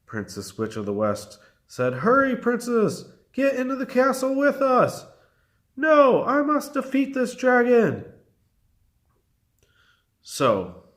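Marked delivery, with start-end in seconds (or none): 2.0-5.0 urgent calling. A narrator's reading. Witch calling friend to safety. 5.8-8.0 determined refusal. A narrator's reading. Witch refuses to flee.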